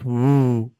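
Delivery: light ghost woo; a mild ghostly woo; ghostly call. A narrator's reading. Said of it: "swooped low and called".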